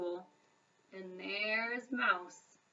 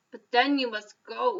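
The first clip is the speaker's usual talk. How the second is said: sniffling; 7 dB louder than usual. A narrator's reading. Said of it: "he sniffled".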